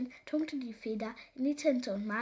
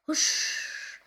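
whooshing whoosh; 6 dB louder than usual. A narrator's reading. Imitated rush of flying past.

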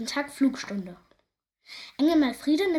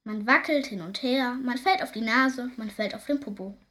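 plain reading voice

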